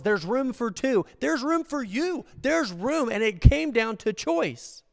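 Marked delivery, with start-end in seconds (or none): 0.0-3.1 much higher, slow tender invitation; voicing Jesus' offer. Jesus offers room to both, slow and high. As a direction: lift it gently like an open door. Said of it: "The offer was".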